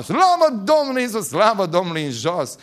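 loud, high shouted praise fading off; shouting praise. Retold or imitated. Churchgoer shouting praise through the service, loud. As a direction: holler it, letting it drop. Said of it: "care striga".

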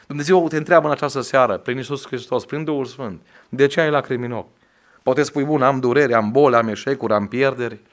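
preacher still in full flight; fervent preaching. Acted. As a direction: keep pressing the congregation. Preacher continuing at full force.

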